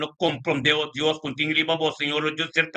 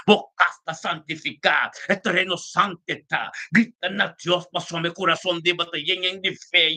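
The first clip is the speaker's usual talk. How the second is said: higher, urgent preacher's declaration; impassioned exhortation; 3 semitones higher than usual. Real, not acted. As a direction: build the prayer into a fervent charge. Prayer rises into exhortation.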